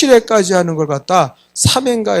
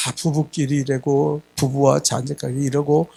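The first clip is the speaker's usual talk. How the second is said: mock counselor tone; 4 semitones lower, 6 dB quieter than usual. Mocking a counselor-like pastor.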